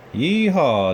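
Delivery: loud cowgirl whoop; yee-haw shouted at the waterfall; excited yell. A narrator's reading. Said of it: "Jessie yelled".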